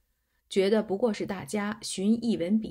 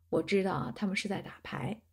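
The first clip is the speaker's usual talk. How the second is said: usual speaking voice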